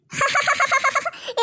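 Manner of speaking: high gleeful cackle; long gloating ha-ha burst; uncontrollable laughter. Acted. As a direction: laugh as if you can't stop. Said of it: "என்னால சிரிப்பு தாங்க முடியல".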